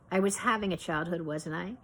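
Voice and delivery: ordinary delivery, nothing marked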